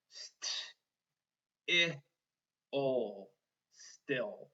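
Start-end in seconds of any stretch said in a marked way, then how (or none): 0.1-3.3 ST, short I as "ih", then OL, pauses between; finger-spelled sounds. Shown as a demonstration. Teacher finger-spelling still, sounded out. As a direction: sound out ST, I, OL one by one. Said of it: "When I finger spell still".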